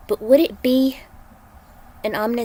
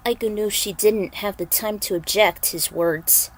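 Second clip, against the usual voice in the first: usual speaking voice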